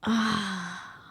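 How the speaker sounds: mock-delighted gasp into a sigh; gasp, then sigh out a soft "ah"; dreamy gasp. Co-host dreaming of an ice cream cone, mock-delighted gasp.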